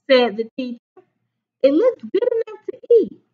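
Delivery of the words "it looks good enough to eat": high, fast praise. Acted. Teacher praising.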